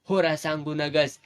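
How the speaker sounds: plain reading voice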